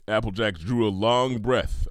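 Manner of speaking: ordinary delivery, nothing marked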